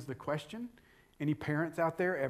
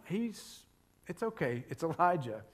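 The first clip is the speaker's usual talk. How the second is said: normal talking voice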